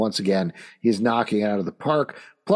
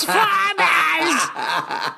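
high shouted evil cackle; supervillain laugh. Acted. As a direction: cackle triumphantly like a villain. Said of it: "You sound like fucking supervillain".

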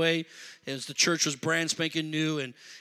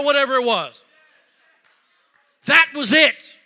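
high, loud preaching story; full-force preaching; 10 semitones higher, 11 dB louder than usual. Acted. Preacher telling the church his story, loud and high. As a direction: drive the story home to the crowd.

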